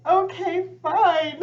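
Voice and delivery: high, loud mock surrender; exasperated giving-in. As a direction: concede to God with comic exasperation. Retold grudging surrender to God.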